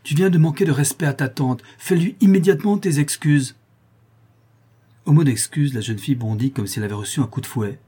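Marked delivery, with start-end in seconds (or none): 0.0-3.5 loud, raised, indignant scolding; thundering reprimand. A narrator's reading. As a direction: order an apology in a loud, high voice. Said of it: "tonna Sébastien en frappant sur la table".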